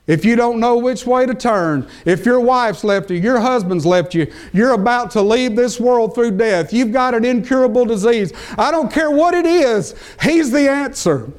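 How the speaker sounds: preacher rising to a fervent high peak; preaching crescendo. Acted. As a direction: build the list to a peak. Preacher builds to climax.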